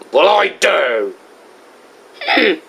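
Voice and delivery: normal talking voice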